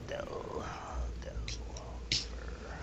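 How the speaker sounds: breathy whispered word; whispering. Acted. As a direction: whisper the name. GM whispers name.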